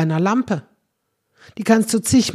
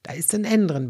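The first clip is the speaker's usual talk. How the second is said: ordinary talk, nothing marked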